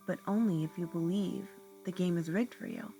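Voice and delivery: normal talking voice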